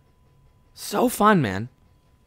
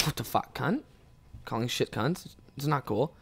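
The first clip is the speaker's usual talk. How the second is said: softer put-on British accent; 4 semitones lower, 12 dB quieter than usual. Fake Brit complaining after tripping on the sidewalk, soft British accent. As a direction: grumble softly in a mock British accent. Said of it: "we were just so British".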